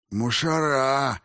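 high, drawn-out lisped cry; lisping injured thug. A narrator's reading. Beaten thug reacts to police sirens, lisping. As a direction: stretch it out through broken teeth.

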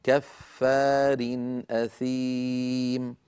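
slowly recited "كفار أثيم", long vowels, short clear tanween; clear izhar. Shown as a demonstration. Teacher showing tanween before hamza, "كفار أثيم" recited slowly with unlengthened tanween. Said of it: "هكذا".

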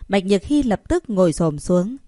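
plain reading voice

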